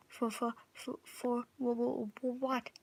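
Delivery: frightened stammer; stuttering. Acted. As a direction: stammer the question in fear. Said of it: "I ask, stuttering".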